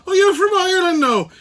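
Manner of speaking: high, loud Irish lass; high-pitched mock female voice; imitated woman at the bar. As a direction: pipe up as a surprised pub lass. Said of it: "she turns around and she goes".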